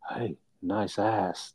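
slow, soft come-on; lightly voiced sleazy compliment, slow and soft; mock flirty line. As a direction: drawl it out like a cheeky pickup line. Retold flirty line to Frog.

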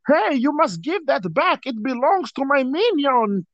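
high, exaggerated cartoon villain; high villain impression, slowing at the end; Gru. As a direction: demand it in a hammed-up villain voice. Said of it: "did the entire video in that voice".

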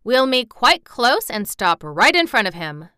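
loud, imperious instruction; firm lordly command. A narrator's reading. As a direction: give orders like royalty. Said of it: "he commanded".

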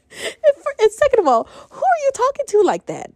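high-pitched, laughing outrage; venting in disbelief; exasperated and half laughing; indignant. Real, not acted. Indignant retelling of insult.

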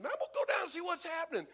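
high, quick excited plan; can't wait to go see; eager. Retold or imitated. Peter deciding to visit Antioch, high and eager.

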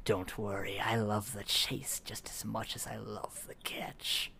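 slow, breathy monster taunt; menacing whisper. Acted. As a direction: whisper it like a stalking predator.

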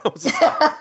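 laughs through the words, high-pitched; laughing. Real, not acted. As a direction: breaks up laughing. Laughing hard mid-story.

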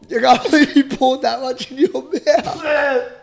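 high, loud laughing. Real, not acted. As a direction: laugh it out in disbelief. Laughing at friend.

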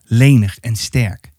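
usual speaking voice

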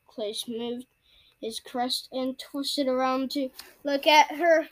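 usual speaking voice

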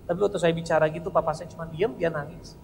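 ordinary talk

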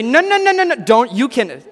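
silly high falsetto for a huge man, loud and protesting; squeaky huge guy. Acted. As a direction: play the big guy with a tiny squeaky voice. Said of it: "that's probably not how he would sound".

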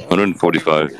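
Johnny Depp. Acted. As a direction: answer low with thoughtful pauses. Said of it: "Johnny Depp is on the show".

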